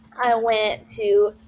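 drawn-out, word by word; slow, deliberate dictation; slow typing dictation. Shown as a demonstration. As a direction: spell it out slowly as if typing. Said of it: "then they're gonna type".

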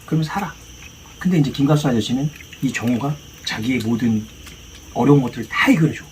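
usual speaking voice

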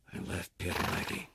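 hushed, fading whisper. A narrator's reading. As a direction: let it die away to a breath. Grim ending of the chase.

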